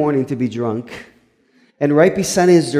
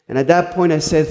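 ordinary talk

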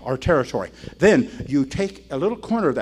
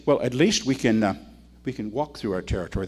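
softer, lower, gentle wife; sweetly; 5 semitones lower, 5 dB quieter than usual. Retold or imitated. Wife gently answers her grumbling husband, sweetly. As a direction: soothe him sweetly. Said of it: "the wife says sweetly".

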